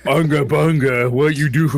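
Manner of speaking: caveman voice. Retold or imitated. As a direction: grunt it out like a caveman. Caveman talk.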